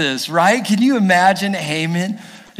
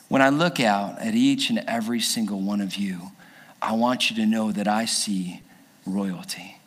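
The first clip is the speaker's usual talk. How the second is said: tender sincere; 8 semitones lower, 6 dB quieter than usual. Real, not acted. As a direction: speak it gently to each listener. Tender appeal to congregation.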